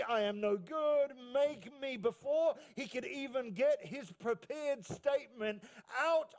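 slow, drawn-out, mounting declamation; impassioned preaching. Acted. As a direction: stretch the interrupted plea for suspense. Preacher builds to the interrupted confession.

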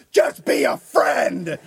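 slow, high, loud mock demand; imitated scream. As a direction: stretch out the furious girlfriend's yell. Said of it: "are fucking screaming at each other".

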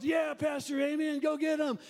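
high, loud acted cheering; fired-up amen. Front-row member cheering on his pastor, all fired up. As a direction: shout encouragement like an overeager congregant. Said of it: "he's all fired up".

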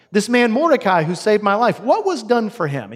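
the king jolts awake, shocked; sudden realization, voice climbing; startled king. Acted. King suddenly remembers his rescuer.

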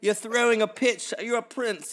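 high, loud, stretched mock-British; bad British accent. Fans correcting the confused prince, hammed-up British accent. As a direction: correct him in a hammy British voice. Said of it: "And that's my British".

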